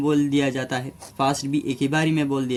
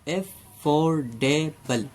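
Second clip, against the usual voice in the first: "Affordable" धीरे: "अ… four… डे… बल"; "affordable" के चारों सिलेबल के बीच ठहराव; चार हिस्सों में; much slower than usual. Shown as a demonstration. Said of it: "चार हिस्सों में हमने बोला". ("अफोर्डेबल" को गिनाते हुए तोड़कर बोलें)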